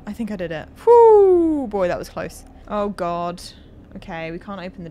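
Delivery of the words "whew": loud relieved whew. Real, not acted. Relief after escaping danger.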